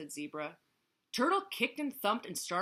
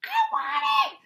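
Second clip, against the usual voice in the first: piercingly high screeched demand; screeching; 13 dB louder than usual. A narrator's reading. Turtle demands the bracelet, screeching. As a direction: screech it at top volume. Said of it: "screeched Turtle".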